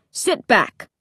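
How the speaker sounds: curt, irritated dad; mildly snappish parental command; snapping. A narrator's reading. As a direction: order the kid back, annoyed. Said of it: "he snapped".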